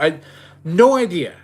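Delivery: usual speaking voice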